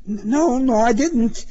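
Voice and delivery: high, stammering protest; flustered, high-pitched backpedalling; frightened denial. A narrator's reading. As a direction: deny it timidly. Driver denies cop's accusation.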